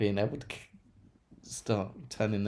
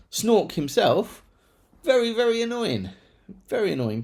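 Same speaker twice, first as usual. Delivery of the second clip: exasperated; 9 semitones higher, 5 dB louder than usual. Real, not acted. Exasperated rant about Snork.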